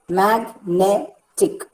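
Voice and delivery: "magnetic" very slowly as mag, ne, tic; syllable by syllable. Shown as a demonstration. Teacher dividing a word for students, syllable by syllable. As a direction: break "magnetic" into three separated beats. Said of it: "can be divided into three syllables".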